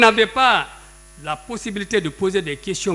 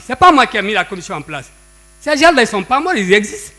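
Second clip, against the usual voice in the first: loud, higher-pitched exasperated insistence; worked up; 3 semitones higher, 5 dB louder than usual. Real, not acted. Witness repeating to the court what was said, worked up. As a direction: push each point out forcefully, really annoyed. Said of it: "ne vous énervez pas".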